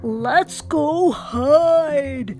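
loud, slow drawn-out call; urgent ghoul shout. A narrator's reading. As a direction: urge everyone to hide. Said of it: "shouted Ghoul".

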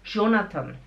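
Jonathan starting with a French J sound; French-influenced pronunciation. Shown as a demonstration. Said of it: "Então a pessoa fala". (Jonathan com J afrancesado)

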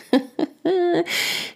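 high breathy giggle; a quick real high laugh. Real, not acted. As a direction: giggle fondly at the memory. Remembering toddler daughter's antics.

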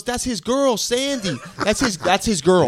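high-pitched imitation of a girl; overheard girl. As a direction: mimic her proudly identifying a character. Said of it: "She goes".

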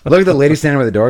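normal talking voice

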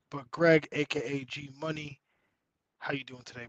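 ordinary talk, nothing marked

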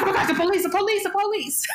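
high-pitched mock panic; paranoid panic. Paranoid friend warning a packed car, mock panicked. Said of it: "'cause I got really paranoid".

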